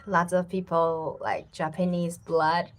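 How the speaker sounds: ordinary talk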